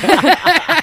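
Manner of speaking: high squeal of laughter. Real, not acted. Laughs after downloading it.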